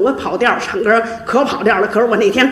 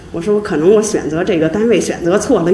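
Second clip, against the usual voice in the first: ordinary delivery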